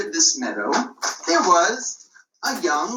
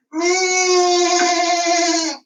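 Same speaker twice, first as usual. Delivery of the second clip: high alarmed bleat; a sheep crying out in panic; frightened lamb's cry; scared sheep bleat; 11 semitones higher, 4 dB louder than usual. Acted.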